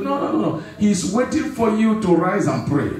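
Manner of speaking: normal talking voice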